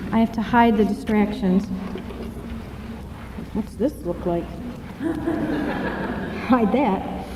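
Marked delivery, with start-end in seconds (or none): 3.5-7.2 quiet laugh. Real, not acted. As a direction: chuckle while hiding something. Laughing at own distractions.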